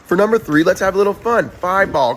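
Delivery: ordinary delivery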